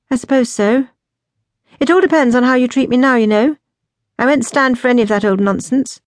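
pointed, no-nonsense younger sister; outspoken. A narrator's reading. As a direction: lay down terms to your sister. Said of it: "her outspokenness".

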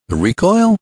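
high-pitched, puzzled echo; wondering question. A narrator's reading. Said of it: "questioned Ned wonderingly".

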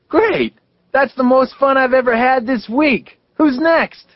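very high, delighted whoop; thrilled shout. A narrator's reading. Phineas surfaces from the river, thrilled and shouting. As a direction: burst up out of the water thrilled.

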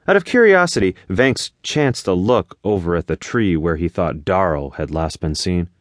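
ordinary delivery, nothing marked

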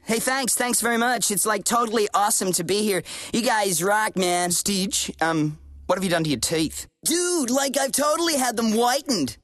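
put-on surfer-dude American accent; hammy valley-dude American accent. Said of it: "what's with the accent?".

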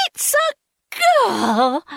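high happy shriek; joyful cry. A narrator's reading. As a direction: burst out with delight.

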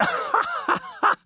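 high scornful cackle; mocking laughter. Papa and uncle Adolf mocking the lawyer, high scornful laughter. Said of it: "Hohngelächter".

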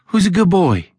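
high, sweet dog-talk; friendliest voice. A narrator's reading. Alan calming a strange dog, very friendly voice. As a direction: coo it high to win the dog over. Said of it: "asked in the friendliest voice possible".

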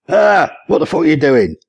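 high startled yelp, then indignant question; startled cry. A narrator's reading. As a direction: cry out as if rudely woken. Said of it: "Wayne cried out".

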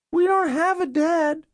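put-on very high little-girl voice; young girl. Girl pleading with caseworkers at the door, young child voice. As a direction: pitch it way up like a small child.

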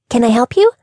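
high, bright, breathy chirp; sugary customer-service voice; cheery high-pitched receptionist. Acted. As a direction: greet her like a bubbly receptionist. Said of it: "with a cheery smile and a high-pitched, breathy voice".

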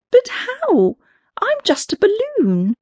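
high, unsure little balloon; timid little creature asking; small balloon voice. Acted. Balloon speaks.

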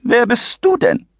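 put-on taken aback by the boy; shrill startled lady; astonished. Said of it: "sagte die Frau erstaunt".

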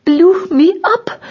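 high, slow, breathless queen voice; panting. A narrator's reading. Queen still out of breath, panting high and slow. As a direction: gasp it out high and loud. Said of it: "panted the queen".

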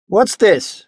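high-pitched imperious demand; imposing. A narrator's reading. Marquess confronting the onlookers at the riverbank, imposing voice. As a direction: demand it like a lord. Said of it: "in his most imposing voice".

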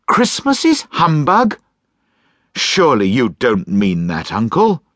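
high, lively voice, gently disbelieving; the kind nephew, surprised but friendly; cheerful young nephew. Acted. Nephew pleading.

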